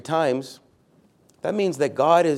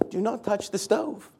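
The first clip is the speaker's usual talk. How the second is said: high-pitched exclaimed warning; exclamation-point emphasis; 7 semitones higher, 3 dB quieter than usual. Shown as a demonstration. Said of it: "We can put an exclamation point".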